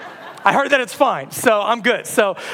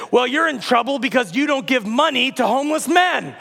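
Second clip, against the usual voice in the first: higher-pitched scolding proclamation; raised, insistent prophet voice; accusing prophet; 4 semitones higher than usual. Acted. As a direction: denounce them like a street prophet.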